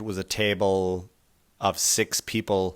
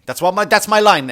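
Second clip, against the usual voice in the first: ordinary talk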